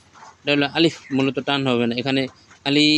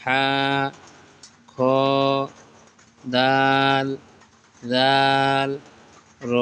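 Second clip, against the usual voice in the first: letter names stretched long, one by one; slow, stretched Arabic letter names; drawn-out recitation; much slower than usual. Shown as a demonstration. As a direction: draw out each letter name like a Quran teacher. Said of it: "আবার এটা আমরা আবার পড়ব".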